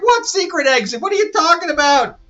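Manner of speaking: high, loud confused demand; baffled Yara. Retold or imitated. Yara asking Cassie about the exit, high and baffled. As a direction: squeak out the disbelief. Yara's confused retort voiced.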